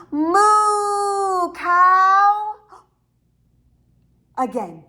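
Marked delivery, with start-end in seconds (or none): 0.1-2.5 louder and higher called moo; even louder moo. Shown as a demonstration. Teacher leads the kids in an even louder moo to the cow. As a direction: really belt out the moo to the cow. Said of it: "Let's do it even louder".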